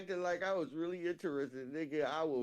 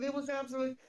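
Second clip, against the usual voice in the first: fake-sweet compliment pitched much higher; mock sweet; 10 semitones higher, much faster than usual. Lying to the boy about his food, sugary higher voice. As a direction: gush it falsely, up high. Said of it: "I said".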